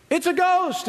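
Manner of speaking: high quick hammed-up panic; playful fake fright, pitched high; mock frightened squeal. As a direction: yelp it like a spooked disciple. Mimics disciples' fear again.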